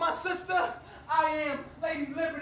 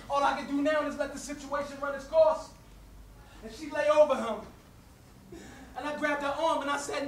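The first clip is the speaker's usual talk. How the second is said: normal talking voice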